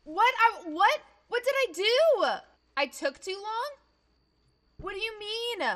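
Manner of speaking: high, incredulous outburst; high-pitched, aggrieved confusion; bewildered protest. Real, not acted. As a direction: whine in baffled disbelief. Baffled at failing to help.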